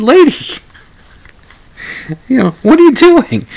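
high, loud mock-exasperated "Lady!"; exasperated outcry. Host retelling what he said to the woman, high and loud in mock exasperation. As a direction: throw up your hands at her in disbelief.

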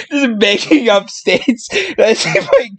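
laughing while talking. Real, not acted. Podcaster mocking own made-up story facts, laughing while talking. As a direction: squeeze the words out through laughs.

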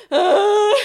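loud, high cry of pain. Retold or imitated. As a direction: yelp as it hurts. Pain cry in a hoop class.

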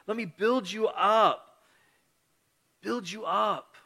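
high-pitched, earnest pep talk; encouraging. Acted. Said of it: "So we are to encourage".

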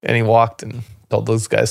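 normal talking voice

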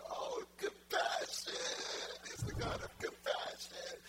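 slow, breath-heavy cry of awe; hammed-up near-weeping wonder; tearfully overwhelmed. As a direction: act overcome, stretching out the words. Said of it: "he could have cried for an hour".

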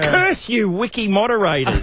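mock villain shaking his fist; hammed-up curse at the sky; sputtering foiled villain. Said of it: "Shaking his fist at the screen".

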